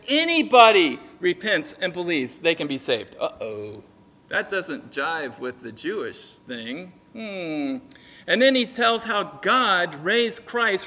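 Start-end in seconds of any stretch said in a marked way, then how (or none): 3.2-7.8 low, quiet thinking aloud; slow, subdued voice of a doubtful onlooker; suspicious musing. Acted. Guy in the back hears Peter preach, quiet suspicious musing.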